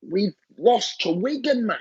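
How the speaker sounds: high-pitched exasperated replay of despair; frustrated venting. Retold or imitated. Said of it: "It's so frustrating".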